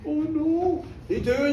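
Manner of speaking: ordinary delivery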